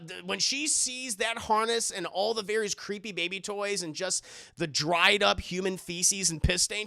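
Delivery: ordinary delivery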